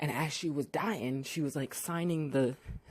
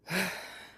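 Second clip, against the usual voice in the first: short exasperated exhale; acted, unconvinced sigh; skeptical sigh; 4 semitones higher than usual. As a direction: sigh as if not buying it. Doubting the kid's denial.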